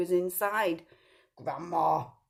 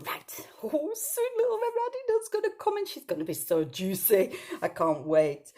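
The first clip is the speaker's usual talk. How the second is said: much higher, eager wolf gloating; greedy wolf thinking; 10 semitones higher, 4 dB louder than usual. Acted. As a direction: relish the thought of dinner.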